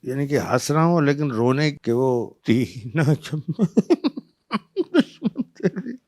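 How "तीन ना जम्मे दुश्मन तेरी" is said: mock crying laughing. Actor laughing through a crying scene, mock sobbing. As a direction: fake sobbing that breaks into a laugh, slow. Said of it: "अब मैं रोते हुए उसमें हंस".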